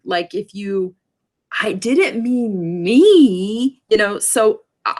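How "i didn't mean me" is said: slow, drawn-out emphasis on each word; emphatic stress. Shown as a demonstration. Teacher demonstrating emphasis with the voice. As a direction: lean hard into me. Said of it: "I just did something with my voice".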